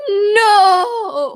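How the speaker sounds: high, stretched, wailing no; drawn-out dramatic no. Retold or imitated. Imitating the show character's upset drawl, long wail. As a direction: draw it out like an over-the-top soap diva. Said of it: "she draws it out, like".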